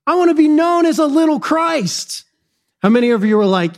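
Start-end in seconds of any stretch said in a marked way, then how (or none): 0.0-2.2 high, eager voice of the early Christians. Retold or imitated. Early Christians cheerfully inviting insults, in high excitement. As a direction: gladly welcome the insults. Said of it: "the early Christians were like".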